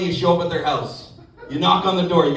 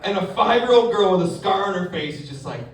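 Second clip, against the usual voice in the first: ordinary talk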